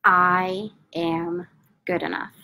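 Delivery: slow, calm affirmation with long pauses; measured, soothing repetition; slow guided affirmation. Acted. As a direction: lead them through the tapping mantra gently. Tapping affirmation script, long pauses.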